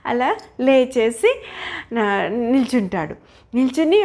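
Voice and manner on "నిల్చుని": ordinary delivery, nothing marked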